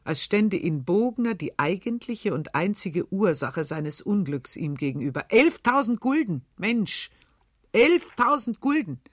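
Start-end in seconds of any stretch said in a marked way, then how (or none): 5.3-9.0 raised, higher voice blurting the sum; loud, high, uncontrolled exclamation; unrestrained outburst. A narrator's reading. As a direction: let the number burst out of him. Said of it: "Und unbeherrscht".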